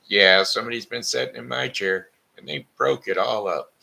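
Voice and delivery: high, loud little cub voice; baby bear. Acted. Baby bear finds his chair broken, high and loud. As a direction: wail it like an upset tiny bear.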